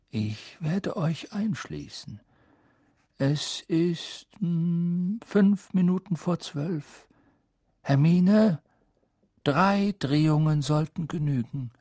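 Dumbledore. A narrator's reading. Headmaster at the door giving a coded hint, deliberate. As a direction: give instructions with pointed pauses.

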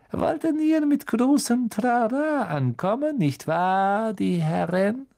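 scornful schoolmaster sneer; sneering teacher. Acted. Snape mocking the boys.

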